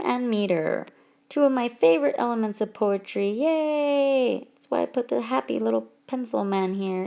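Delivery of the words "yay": high, drawn-out yay; playful cheer. Pretend. Teacher on two favorite elements of poetry, playful cheer.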